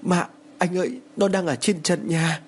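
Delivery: high, drawn-out alarm without volume; understated scream to her husband; slow, high-pitched cry of fear; frightened cry. A narrator's reading. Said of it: "Cô liền hét lên".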